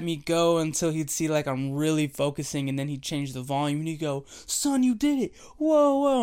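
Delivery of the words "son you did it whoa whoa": high, loud mock-amazed whoa; a father playing along with his son's trick; cheering dad. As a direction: ham up a dad's fake astonishment. Dad cheering son in car.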